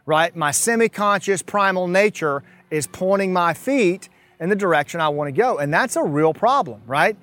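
normal talking voice

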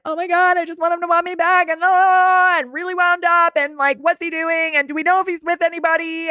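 high, fast, anxious spiraling; mock-panicked obsessive rambling; frantic inner voice. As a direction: rattle it off like a wound-up ex-girlfriend. Mocking anxious heartbroken thoughts.

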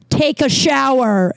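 high, drawn-out mock mom command; mom's stern order. Mom phoning the dorm to scold her son, stern mock order. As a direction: stretch it out like an exasperated mother's order.

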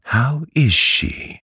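slow, high-pitched question; high, insistent aunt; demanding. A narrator's reading. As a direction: ask it slowly and pointedly, pitch well up. Aunt demands news of mother.

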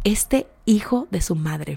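hushed and slow, seething; quiet, slow muttered disbelief. Real, not acted. To the viewers about Jeremy, a hushed slow seething aside. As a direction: let it sink in quietly, slowly.

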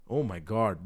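ordinary talk

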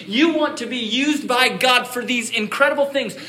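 high, loud rallying sermon; preaching at full force. Acted. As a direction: rouse the congregation to answer.

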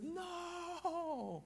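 high, laughing cry of dismay; the crowd groans as he scores on his own basket; half-laughing no from the stands. Retold or imitated. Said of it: "everyone is like".

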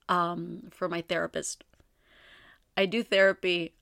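ordinary delivery

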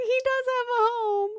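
high teary cry; mock tearful. Retelling crying at the movie, high tearful voice. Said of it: "I started crying".

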